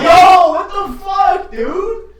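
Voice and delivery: loud, high-pitched disbelief; real startled outburst; startled shout. Real, not acted. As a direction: yell out at a sudden mishap. Startled by phone glitch.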